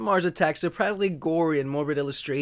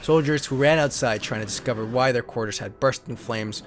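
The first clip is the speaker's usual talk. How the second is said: lower, steady storybook narrator voice; story narration; 7 semitones lower than usual. Acted. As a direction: read the card like an old sci-fi serial. Reads card story as narration.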